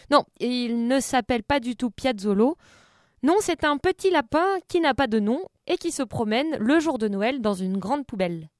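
usual speaking voice

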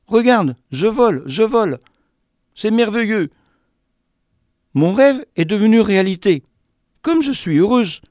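voix de mésange, nettement plus aiguë et ravie; joyful little bird. Acted. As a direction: petit oiseau émerveillé de voler. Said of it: "m'adressa la parole". (la mésange parle au narrateur, aiguë et ravie)

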